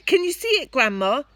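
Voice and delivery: put-on high, loud boyish call; shouting excitedly. A narrator's reading. George shouting up to Grandma on the roof. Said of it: "George shouted".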